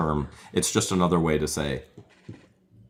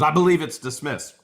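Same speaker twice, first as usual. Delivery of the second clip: mock witness claim; 9 semitones higher, 4 dB louder than usual. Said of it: "She goes".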